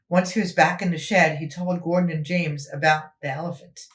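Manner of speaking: usual speaking voice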